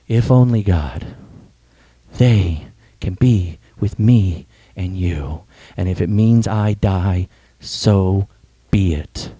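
slow, low, weighted plea; solemn prayer. Retold or imitated. Jesus standing up to God for his people, solemn and slow. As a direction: pray it slowly, with pauses between phrases. Jesus praying, ready to die.

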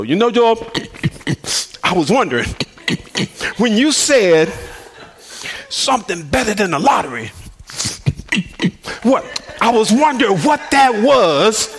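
a hint of a congested friend, clearing his throat; snoring friend. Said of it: "he sorta snored 'cause he always had".

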